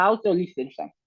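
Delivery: ordinary talk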